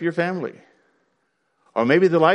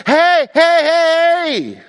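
high, loud warning yell; scream; 14 semitones higher, 6 dB louder, much slower than usual. Acted. Preacher screams at someone on the wrong path, loud warning cry. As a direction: holler with a long drawn-out last word. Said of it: "you can just scream and say".

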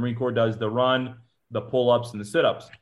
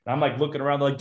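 ordinary delivery

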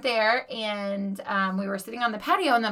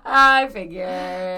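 knowing drawl; 7 dB louder, much slower than usual. Real, not acted. Knowing repeat, drawn out.

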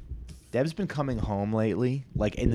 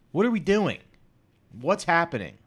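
exasperated; 8 semitones higher, 4 dB louder than usual. Real, not acted. Rant about restaurant prices.

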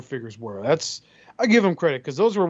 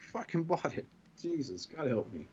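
laughing under breath; 14 dB quieter than usual. Real, not acted. Laughing aside.

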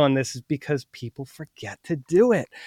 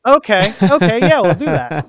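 higher, louder ready consent; agreeable seller; 5 semitones higher, 7 dB louder than usual. Acted. Seller accepting the two-buck offer, higher and agreeable. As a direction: give in cheerfully, pitched up. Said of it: "they'll go".

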